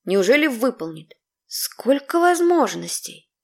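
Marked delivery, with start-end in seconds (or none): none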